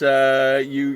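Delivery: usual speaking voice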